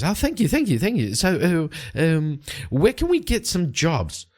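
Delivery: high, eager gushing thanks; excited thanks. Acted. Jean thanks Light for joining, high and excited.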